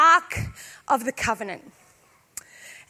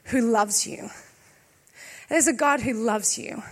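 low, soft and sincere; quiet, heartfelt assurance; tender reassurance; 7 semitones lower, 5 dB quieter than usual. Real, not acted. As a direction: lower the voice, let it land gently. Preacher's tender assurance to visitors.

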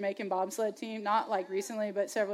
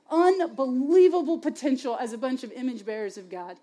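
ordinary talk

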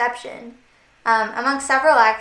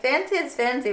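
ordinary talk

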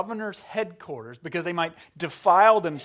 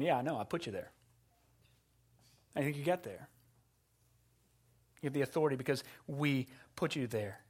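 quiet Jesus voice; 2 semitones lower, 7 dB quieter, faster than usual. Acted. Jesus voiced answering Pilate.

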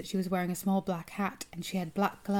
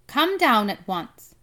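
loud, slow, raised-pitch call; 4 semitones higher, 9 dB louder, slower than usual. A narrator's reading. Grandmother calling the boy down from the tree, calling out. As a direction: call up firmly, stretching the words. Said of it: "she called out".

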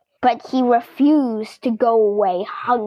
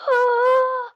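gasp; 10 semitones higher than usual. Real, not acted. Reader gasps at the reveal.

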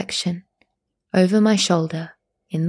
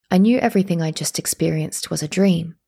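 ordinary delivery

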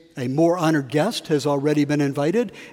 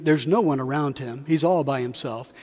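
ordinary talk, nothing marked

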